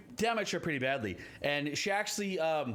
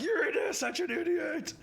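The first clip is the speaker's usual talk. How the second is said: bickering insult; 13 semitones higher than usual. Acted. Ettin heads bickering with each other over the missed attacks. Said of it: "looking at each other like".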